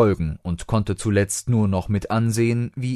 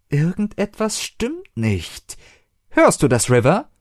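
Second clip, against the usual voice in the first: wary; 10 semitones higher than usual. A narrator's reading. Doctor senses danger.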